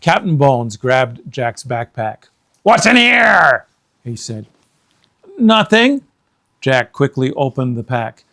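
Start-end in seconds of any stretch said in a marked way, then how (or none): 2.6-3.6 high, loud demanding question; Captain Bones questioning Jack; pirate captain. Acted. Captain grabbing backpack. 5.4-6.0 put-on nervous boy. Jack answering pirate.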